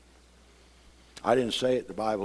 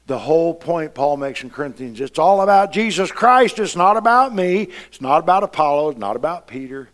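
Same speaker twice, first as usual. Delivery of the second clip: preaching at full force; 4 semitones higher, 8 dB louder than usual. Acted. Preaching rising again.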